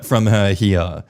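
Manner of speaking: normal talking voice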